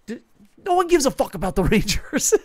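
high, loud laugh breaking through the words; real laughter while dismissing the Rangers. Real, not acted. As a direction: crack up mid-sentence at the rival team. Mocking a rival team.

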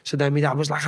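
usual speaking voice

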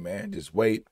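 ordinary talk, nothing marked

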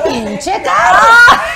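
loud, high, drawn-out curse; imitated cursing, hammed up; shouted insult. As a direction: yell it slowly for comic shock. Retold insult overheard through the boom.

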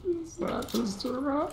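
high, squeaky joking repeat; squeaky mock voice. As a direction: pitch it way up like a cartoon. High-pitched repeat of the joke.

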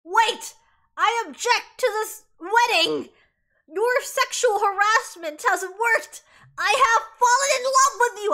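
loud, slow mock declaration; dramatic objection. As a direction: proclaim it grandly, hammed up for the crowd. Adam objects at the wedding.

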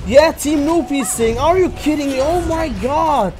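high, loud, incredulous raging at teammates; outraged rant. Real, not acted. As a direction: scream disbelief at the useless team.

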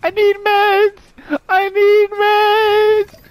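high, loud panicked shriek; wailing at the top of the voice; desperate scream. Real, not acted. One health point left.

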